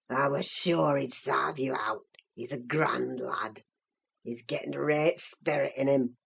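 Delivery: put-on very low, gloating old servant voice; malignant crackly old man. A narrator's reading. Odious old servant mocking the two shut out, malignant and crackly. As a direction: gloat darkly in dialect, with crackly chuckles. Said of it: "crackly laugh by the fire".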